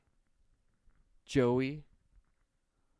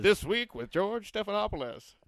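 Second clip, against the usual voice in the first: announcer voice; 8 semitones higher, 4 dB louder than usual. Pretend. Announcing a show title.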